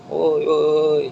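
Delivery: high drawn-out crowd call; teriakan penonton. Acted. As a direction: call out high like a spectator in the stands. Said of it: "Capek, sudah teriak". (teriakan penonton bernada tinggi)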